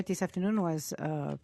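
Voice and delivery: ordinary talk, nothing marked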